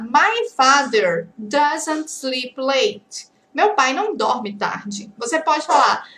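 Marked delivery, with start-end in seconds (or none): 0.0-3.3 "My father doesn't sleep late" drawn out word by word; very slow model sentence. Shown as a demonstration. Teacher modelling a sentence very slowly for students. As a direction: read it out slowly for learners.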